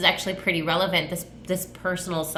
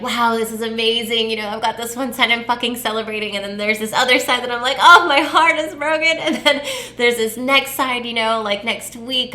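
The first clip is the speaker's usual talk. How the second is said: excited; 5 semitones higher, 6 dB louder than usual. Real, not acted. Excited launch, mixed feelings.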